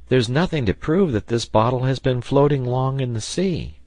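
lightly voiced eager lad; earnest Herbert. A narrator's reading. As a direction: raise the pitch for a keen, youthful objection. Narrator voices young Herbert.

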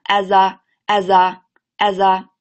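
as a run together slowly; linked as a drill; linking as a. Shown as a demonstration. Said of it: "连起来".

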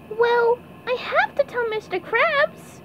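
slow, high cartoon voice; SpongeBob. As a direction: reason it out in a squeaky pipsqueak voice. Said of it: "SpongeBob thought hard".

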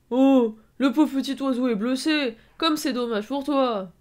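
loud, high, drawn-out taunting; mock pity. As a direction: jeer with fake sympathy. Villain mocks wounded victim. (fausse pitié moqueuse, forte et traînante)

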